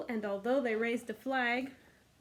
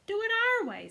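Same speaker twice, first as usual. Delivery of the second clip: much higher, louder demand; insistent twins; 11 semitones higher, 6 dB louder than usual. Acted. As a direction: push your own way. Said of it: "said the twins".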